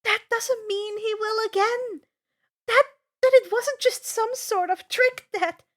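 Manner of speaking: high, loud, anxious pleading; worried objection. A narrator's reading. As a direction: fret that it is a trick. Annabeth objecting, frightened.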